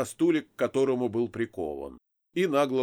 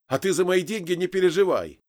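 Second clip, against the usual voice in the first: higher, louder retort; testy, raised-voice comeback; snapping back; 7 semitones higher, 5 dB louder than usual. A narrator's reading. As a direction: snap it back defiantly. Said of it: "огрызнулся я".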